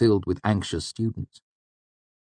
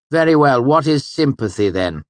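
high, loud, stern master; stern Hemme; 7 semitones higher, 8 dB louder than usual. A narrator's reading. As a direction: hand out the punishment coldly. Hemme resumes lecture.

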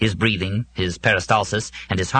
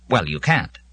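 flatly; 6 semitones higher than usual. A narrator's reading. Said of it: "the robot said flatly".

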